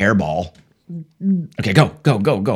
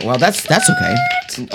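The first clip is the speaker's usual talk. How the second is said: very high, slow put-on squeak; a silly falsetto; squeaky voice; much slower than usual. Joke about his own smarts.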